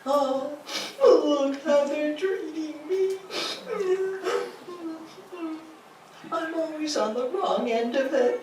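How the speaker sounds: high, self-pitying moan, hammed-up; mock-whine like a wounded victim; pity party whine. Someone mocking a pity party, high and whiny. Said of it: "we throw a pity party".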